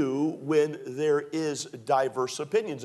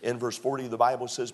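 usual speaking voice

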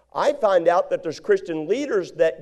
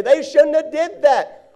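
mock indignant, higher and slow; indignant protest; 7 semitones higher, 4 dB louder, slower than usual. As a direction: imitate someone hurt, protesting slowly. Imagined listener's objection.

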